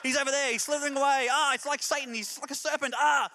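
rising excited yells at the plumber; frantic cheering of a snake hunt; coaching from the side. Retold or imitated. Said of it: "I was more coaching him".

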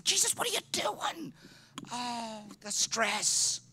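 high, strained voice of outraged disbelief; enraged Peter. Acted. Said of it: "Peter is enraged. He's incensed".

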